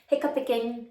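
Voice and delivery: Hiccuping said as hic-cup-pick-ing; Hiccuping tripped with an extra syllable; stumbling over a word. Real, not acted. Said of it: "I can't say that word".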